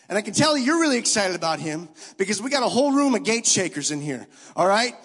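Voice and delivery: loud, fervent preaching to the room; preaching at full force. Acted. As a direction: stir the crowd, full voice. Preaching to the congregation.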